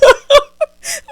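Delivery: loud, high burst of laughter; real big cackle. Real, not acted. Joking about checking twenty dollars, loud high cackle. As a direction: crack up loudly, high-pitched.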